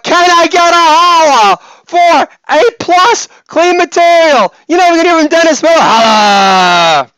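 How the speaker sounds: over-the-top crowd-hyping yell; hype shout. Acted. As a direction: holler for applause at full voice. Mock hype call begging for applause.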